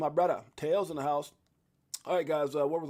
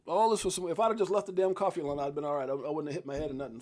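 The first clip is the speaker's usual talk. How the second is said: usual speaking voice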